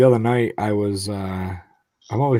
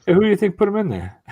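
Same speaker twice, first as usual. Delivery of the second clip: put-on higher kid voice; light kid impression; voicing his son; 11 semitones higher, 5 dB louder than usual. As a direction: play the curious young son, pitched up. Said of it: "He goes".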